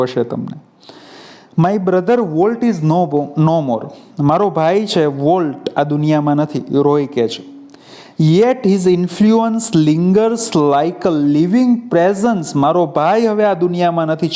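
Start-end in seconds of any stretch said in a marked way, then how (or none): 1.6-3.9 text reading. Acted. 8.2-12.4 text reading. Acted.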